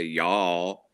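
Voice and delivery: "y'all" in a Southern drawl. Shown as a demonstration. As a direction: show off a little Southern drawl. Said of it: "tend to have a Southern drawl".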